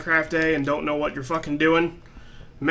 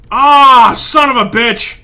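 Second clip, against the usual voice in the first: loud, high groan into a curse; real exasperated outburst; angry cursing; 7 semitones higher, 11 dB louder, slower than usual. Real, not acted. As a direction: curse loudly at the failure. Player curses after another miss.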